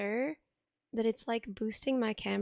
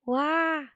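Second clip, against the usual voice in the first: loud test shout; 5 semitones higher, 5 dB louder than usual. Real, not acted. Testing loudness tracking.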